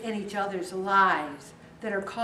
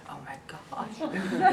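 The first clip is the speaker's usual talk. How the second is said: slow, softer whispered dread; anxious whisper; 5 semitones lower, 6 dB quieter, much slower than usual. Retold or imitated. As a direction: breathe it out in worried suspense. Dreading what husband will say.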